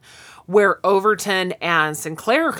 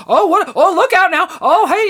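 much higher, louder laughing tease; flirty teasing; 11 semitones higher, 7 dB louder than usual. Retold or imitated. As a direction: hype the couple up playfully. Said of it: "they'd be like".

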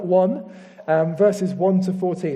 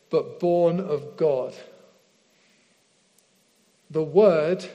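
slow, measured Bible reading with long pauses; slow Scripture reading. Real, not acted. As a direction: read it through slowly, phrase by phrase, letting each rest. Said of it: "I'm gonna read this through slowly".

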